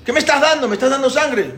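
high-pitched acted outrage; horrified accusation. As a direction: accuse him in shocked disbelief. Descubre sangre al beber. (acusación indignada, voz aguda)

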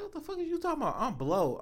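hushed, high-pitched retold protest; mock disbelief. Man retelling his reply in bed, mock disbelief. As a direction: squeak it out softly, imitating disbelief.

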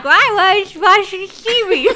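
mock nervous. Nervous student asks why.

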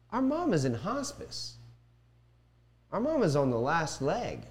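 high, pleading protest at the principal; how can you not see it; indignant retort. Retold or imitated. Brother's reply to the principal.

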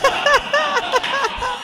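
high burst of laughter. Real, not acted. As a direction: crack up at the memory. Laughing at own story.

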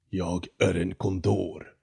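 slow, echoing bird voice; deep hoarse condor. Acted. The condor announcing himself to Jonathan, slow and echoing. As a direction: let it boom out of the tree like an ancient bird. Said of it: "ekade en djup, hes röst".

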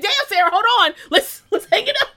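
high, loud, laughing through it; laughing protest. Retold or imitated. Telling Sarah to hold on, laughing loudly. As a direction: laugh while telling her to wait. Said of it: "I was like".

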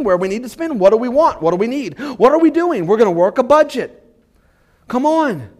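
usual speaking voice

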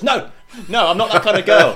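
put-on loud, high-pitched drunken protest; mock indignant refusal. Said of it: "slaps the tree and goes".

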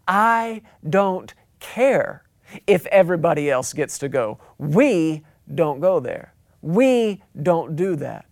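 slow, drawn-out parental refusal; stern parents. Acted. Mom and dad refusing the kid, slow and stern. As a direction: lay down the house law word by word. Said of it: "mom and dad look back at you and say".

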